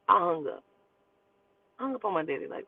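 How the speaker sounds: ordinary delivery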